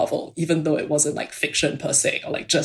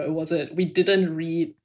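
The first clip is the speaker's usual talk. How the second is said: ordinary delivery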